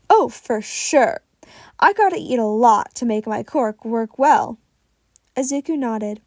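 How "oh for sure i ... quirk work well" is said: louder, hearty hero offering food; booming, friendly mentor; jovial big hero. Acted. Fat Gum's voice in dialogue.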